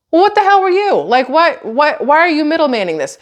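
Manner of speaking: fed-up what-the-hell at the middleman; exasperated outburst. Real, not acted. Exasperated at pointless chat handoff.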